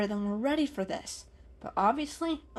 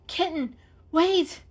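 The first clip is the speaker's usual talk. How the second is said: higher, louder urgent call; 9 semitones higher, 5 dB louder, slower than usual. A narrator's reading. Peaches calling the kitten back, urgently. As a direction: stop him before he runs in. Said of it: "Peaches called".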